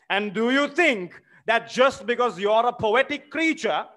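high, loud outraged protest; indignant challenge. Acted. Smirnov challenging the widow, outraged and loud. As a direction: demand how she dares insult him.

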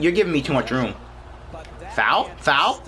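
usual speaking voice

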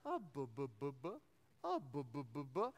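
low repeated burble, swooping up; imitated bubbling noise. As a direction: mimic an odd gurgling sound. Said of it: "noise gets louder and louder".

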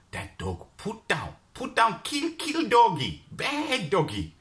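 put-on high, rising Chinese-accented outrage. Stall owner condemning the dog, high Chinese accent. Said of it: "that strong Chinese accent".

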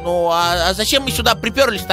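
ordinary talk

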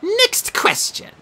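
high prim quizmaster creature; Sphinx voice. Acted. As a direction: play a fussy exam monster.

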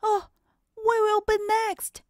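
high, fast nervous excitement; excited. Acted. Fox nearing the front of the line, high and fast. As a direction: rush it out high, almost there.

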